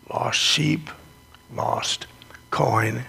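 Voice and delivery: usual speaking voice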